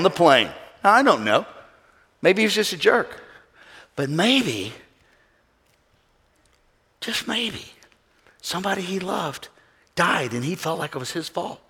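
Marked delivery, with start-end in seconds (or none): 4.0-11.6 much softer, gentle preaching; softly, with compassion, to the congregation; hushed. Acted. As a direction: drop to a near hush to draw them in. Pastor softens on grief.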